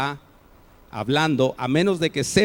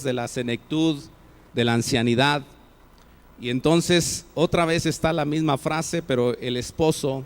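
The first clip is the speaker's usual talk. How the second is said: usual speaking voice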